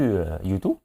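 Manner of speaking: ordinary talk, nothing marked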